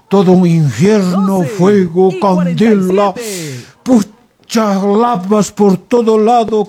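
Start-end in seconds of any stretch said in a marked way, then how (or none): none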